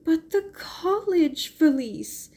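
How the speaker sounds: high, slow, begging voice; a young woman begging high and slow; pleading. A narrator's reading. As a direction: plead with her friend. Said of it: "pleaded Mary".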